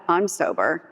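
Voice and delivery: imitated husband shrugging it off; unimpressed reply. Estranged husband answers wife, unimpressed and matter-of-fact. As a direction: toss it back lightly, no lift in pitch.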